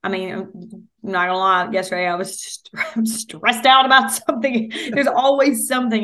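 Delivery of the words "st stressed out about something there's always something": laughing. Real, not acted. Laughs while admitting stress.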